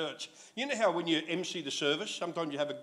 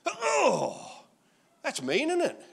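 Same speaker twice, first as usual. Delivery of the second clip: mock hurt; 11 semitones higher, 3 dB louder, much slower than usual. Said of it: "mock-hurt reaction".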